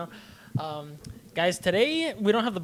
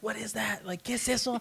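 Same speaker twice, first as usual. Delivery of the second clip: shocked parents; 5 semitones higher, 6 dB quieter than usual. Retold or imitated. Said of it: "they're like".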